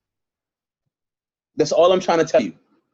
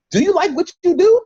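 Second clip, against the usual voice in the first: high, bright-voiced sales question, well above her usual pitch; mock friendly question; 13 semitones higher, 3 dB louder than usual. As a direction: sing it up high like a cheery pitch. Acting out asking a worker.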